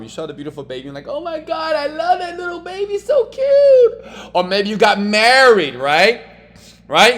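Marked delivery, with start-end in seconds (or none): 1.1-4.3 very high, squealing coo; gushing over a baby. Retold or imitated. Cooing at a cute baby. 4.3-7.2 loud, high, excited urging; hyped up. Real, not acted. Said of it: "hyping the audience".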